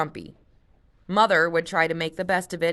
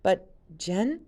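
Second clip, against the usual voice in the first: timid; 4 semitones higher than usual. Acted. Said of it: "Luke said timidly".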